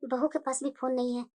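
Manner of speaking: softly muttering mother-in-law; muttering to herself. Acted. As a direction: grumble under your breath.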